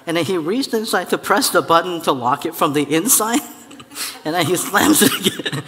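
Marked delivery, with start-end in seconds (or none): none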